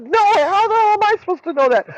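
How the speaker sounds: retold fuming. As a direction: fire it back, indignant and high. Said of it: "So I'm fuming".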